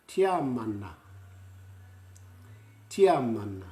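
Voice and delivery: "Tiamana" tee-ah-ma-na, loud and crisp; Māori pronunciation. Shown as a demonstration. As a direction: model "Tiamana" for the class. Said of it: "say it after me".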